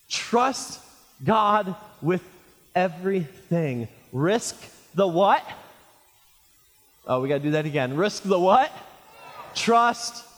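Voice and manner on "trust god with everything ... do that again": ordinary talk, nothing marked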